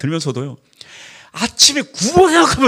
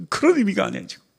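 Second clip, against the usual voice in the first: normal talking voice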